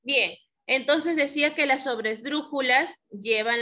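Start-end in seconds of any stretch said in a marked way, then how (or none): none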